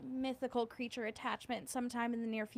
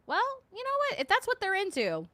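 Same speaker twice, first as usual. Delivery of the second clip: much higher, swooping mock-open voice; exaggerated mock shrug; 11 semitones higher, 4 dB louder than usual. Real, not acted. Streamer reacting to a chat joke, mock-tolerant high swoop.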